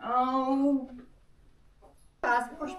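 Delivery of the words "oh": usual speaking voice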